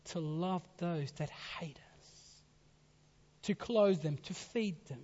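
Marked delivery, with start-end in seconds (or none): 0.1-2.4 slow hushed whisper; soft slow sermon whisper. Real, not acted. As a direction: breathe it out slowly, almost secret. Preacher drops to a hush.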